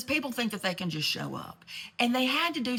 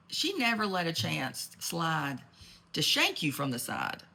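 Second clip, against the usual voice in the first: usual speaking voice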